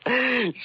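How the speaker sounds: a bright, relieved burst of laughter; happy laugh. Acted. Detective laughing with his wife after the case, relieved happy laugh. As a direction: laugh high with joy at the good news.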